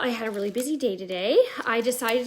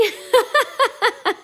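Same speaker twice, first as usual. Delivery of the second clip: high, louder run of giggles; amused laugh; 10 semitones higher, 5 dB louder than usual. Real, not acted. Host asking if she is mumbling, high amused laugh. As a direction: crack up helplessly in high bursts.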